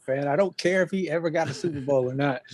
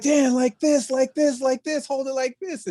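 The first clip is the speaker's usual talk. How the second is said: high, insistent coaching; eager instruction; 9 semitones higher than usual. Retold or imitated. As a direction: urge him to lift it. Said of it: "I'm like".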